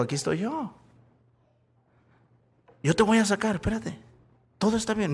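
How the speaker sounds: soft, hushed reassurance; tremendous calm. Acted. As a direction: play a father calmly soothing his frightened child. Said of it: "Mi papá con una tremenda calma". (con tremenda calma, en voz baja)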